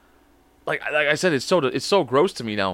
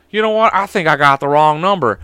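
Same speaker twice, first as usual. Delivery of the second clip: caller voice; 7 dB louder than usual. Retold or imitated. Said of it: "he goes".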